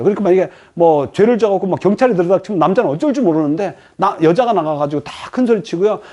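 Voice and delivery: ordinary delivery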